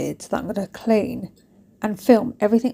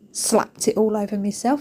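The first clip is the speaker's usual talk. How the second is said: normal talking voice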